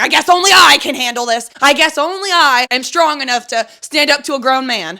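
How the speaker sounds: proudly defiant, loud and high; defiant self-talk. Acted. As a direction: belt it out like a personal battle cry. Acted defiant self-talk to hardship.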